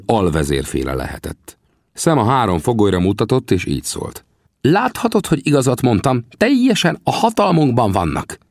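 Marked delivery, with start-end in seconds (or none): 4.6-8.4 high-pitched negotiator; Sam, assured. A narrator's reading. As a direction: state the upper hand in a high voice. (magas, határozott figurahang)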